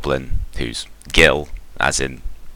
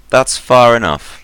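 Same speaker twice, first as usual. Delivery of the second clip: a firm, raised call, higher and louder; pitched up to carry across a distance; 9 semitones higher, 7 dB louder than usual. Acted. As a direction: call out a warning to approaching strangers. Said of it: "he calls out to you".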